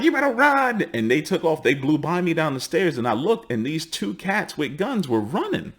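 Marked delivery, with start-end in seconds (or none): 0.0-0.8 high, pressing warning; higher urgent warning. Retold or imitated. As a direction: tell him to flee. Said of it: "And they were like".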